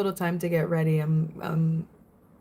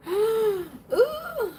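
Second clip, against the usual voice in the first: sharp high gasp and ooh; high frightened gasp; scared gasp; 14 semitones higher than usual. Real, not acted. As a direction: gasp as if the film still scares you. Recalling a scary horror film.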